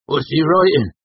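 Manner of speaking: high, loud rustic question; pry loudly from the bar stool; nosy tavern regular. A narrator's reading. Cobb squinting toward the scribe, nosy.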